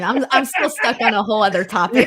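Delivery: talking through real laughter; helpless laughter. Real, not acted. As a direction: struggle to get it out while laughing. Cannot stop laughing at earlier joke.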